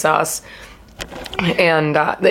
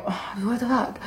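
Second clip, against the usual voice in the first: under the breath, much softer, in disbelief; muttered disbelief; 4 semitones higher, 11 dB quieter than usual. Retold or imitated. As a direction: mutter "what the fuck" to yourself, startled. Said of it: "and I'm like".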